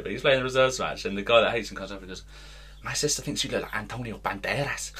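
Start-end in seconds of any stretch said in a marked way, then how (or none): 2.8-4.9 softer put-on Scouse voice; attempted Scouse accent. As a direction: slip into a mock Scouse accent. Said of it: "Why is he Scouse now?".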